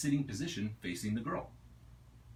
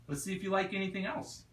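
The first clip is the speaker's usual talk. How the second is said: higher, quicker eager line; 10 semitones higher, faster than usual. Acted. Ania eager to feed Spirit something new, bright and quick. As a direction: bounce it up, curious to try.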